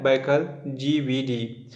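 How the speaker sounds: Baikal GVD said very slowly, each syllable drawn out for students to note; slow, spelled-out repeat. Shown as a demonstration. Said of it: "क्या नाम दिया है?".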